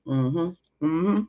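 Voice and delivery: chewing while humming; mouth full. Real, not acted. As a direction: hum through a mouthful. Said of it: "I need time to chew".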